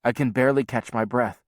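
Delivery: ordinary delivery, nothing marked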